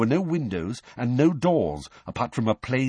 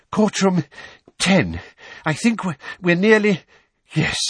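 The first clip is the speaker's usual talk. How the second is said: out of breath; 9 semitones higher, 6 dB louder than usual. A narrator's reading. Weasley after running down steps.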